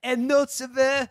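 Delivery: high, slow mock French wine snob; French accent. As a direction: sniff and savor in a hammy French accent. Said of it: "just imagine him ... going".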